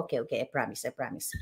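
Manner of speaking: hushed, sheepish okay okay I promise; meek promise. Retold or imitated. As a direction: promise it softly like a scolded child. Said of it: "Sabi ko".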